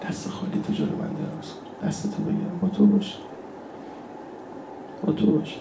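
very soft, half-whispered entreaty; hushed plea. Acted. Worshipper asks the Imam for his hand, hushed plea. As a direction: plead under your breath.

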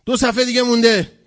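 loud, high-pitched announcement; urging the crowd on. Acted. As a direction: call it out over a tired congregation. Acted prayer leader urging tired crowd. (با صدای بلند و زیر اعلام کردن)

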